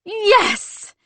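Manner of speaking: high, loud cry of discovery; triumphant cry. A narrator's reading. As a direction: cry out as if you've found it.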